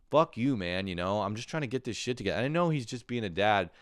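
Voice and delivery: usual speaking voice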